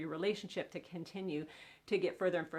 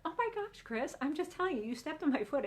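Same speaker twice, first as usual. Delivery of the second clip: exasperated wife; 7 semitones higher than usual. Acted. [name] voiced, exasperated argument.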